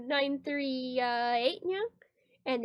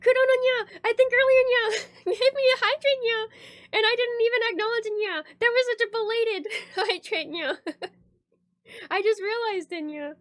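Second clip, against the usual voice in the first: excited; 6 semitones higher, 4 dB louder than usual. Real, not acted. Sudden excited realization.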